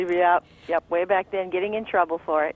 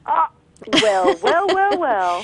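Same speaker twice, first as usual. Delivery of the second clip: mock gloating; 6 semitones higher, 5 dB louder, slower than usual. Mocking the professor.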